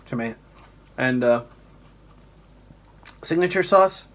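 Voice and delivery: chewing between words; swallowing while talking; mouth full, chewing. Real, not acted. Seen 0: Cheeks bulge, jaw chews, hand at throat while tasting food.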